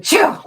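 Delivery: high loud achoo; fake sneeze. Shown as a demonstration. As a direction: imitate a normal sneeze. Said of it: "sneezed like a regular person".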